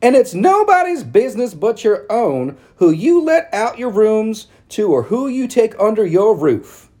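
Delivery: high, loud prissy society matron; prim town lady. A narrator's reading. Narrator voicing Missus Cady calling on Mama, high and prim. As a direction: lift it up into a fussy church lady. Ladies voiced in read story.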